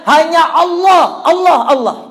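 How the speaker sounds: high, loud, slowed cry to God; child's cry. Retold or imitated. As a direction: cry out for God while fleeing. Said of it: "dia teriak". (teriakan anak memanggil Allah)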